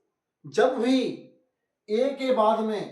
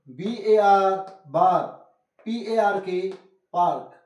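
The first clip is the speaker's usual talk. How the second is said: slow, letter by letter, then the word; slow spelling drill; slower than usual. Shown as a demonstration. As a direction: spell each letter out slowly for the class. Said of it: "आइए एक बार फिर से repeat करते हैं". (धीरे-धीरे अक्षर बोलकर दोहराना)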